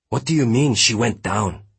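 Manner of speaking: agitated, disbelieving question; alarmed disbelief. A narrator's reading. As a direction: demand an explanation in alarm. Alarmed challenge to officer.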